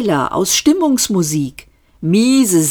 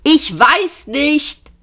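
high, drawn-out yell; yelling back; 9 semitones higher, much slower than usual. A narrator's reading. Ford answering Arthur's question, yelling back. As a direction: yell it back slowly, pitched up. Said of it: "brüllte Ford zurück".

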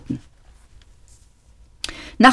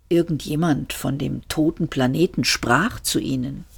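plain reading voice

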